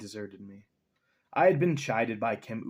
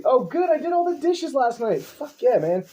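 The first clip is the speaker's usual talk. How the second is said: excited cheer; 12 semitones higher, 10 dB louder than usual. Real, not acted. Cheering over clean dishes.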